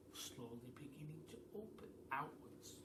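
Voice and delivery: whispered, building tension; suspenseful whisper. A narrator's reading. Narrator watching the window open, tense whisper. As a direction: hush it like a secret.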